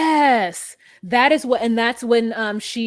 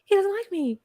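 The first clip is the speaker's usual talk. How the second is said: imitating Puppy; 9 semitones higher than usual. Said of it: "she was like".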